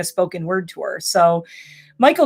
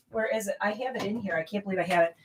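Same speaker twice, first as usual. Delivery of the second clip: much softer, searching under breath; muttering to self; 12 dB quieter, faster than usual. Real, not acted. Digging through old stuff, muttering to herself. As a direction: talk to yourself while rummaging.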